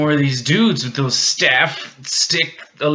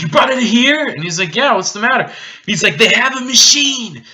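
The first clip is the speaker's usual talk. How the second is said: alarmed; 11 semitones higher, 8 dB louder than usual. Retold or imitated. Alarmed exchange between characters.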